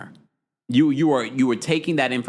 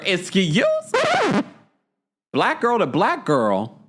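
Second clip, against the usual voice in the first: high, slow, drawn-out excuse me; indignant disbelief; 7 semitones higher, much slower than usual. Real, not acted. Host reacting to Adriana's line with mock outrage. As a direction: stretch it out, offended and incredulous. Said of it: "reacting to the quote".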